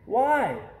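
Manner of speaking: a boy hit by bad news; upset cry. A narrator's reading. Boy protests to Dad about the delay, crying out. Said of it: "I cry".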